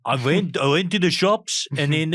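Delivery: mock the internet's lazy South African accent; bad fake South African accent. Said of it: "try to do a South African accent".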